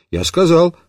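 ordinary delivery

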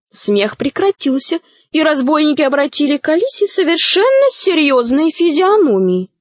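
ordinary delivery, nothing marked

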